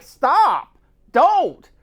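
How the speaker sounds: urgent retold protest. Telling how they begged a coworker to stop, urgent protest. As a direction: call out to halt him, voice jumping up.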